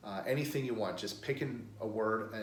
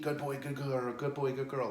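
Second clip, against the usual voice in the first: higher, repeated upbeat praise; excited praise; 4 semitones higher than usual. Shown as a demonstration. Trainer rewarding a dog in the crate, excited. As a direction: gush lightly over the dog. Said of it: "act excited".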